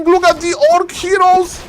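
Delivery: excited caster. Real, not acted. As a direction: call the fight with mounting excitement. Hyped play-by-play climax.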